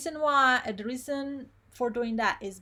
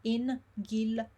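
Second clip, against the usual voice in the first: syllable by syllable. Shown as a demonstration. Teacher shows no syllable is missed, separated syllables. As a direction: break it into four separate beats. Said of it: "You don't wanna miss syllable".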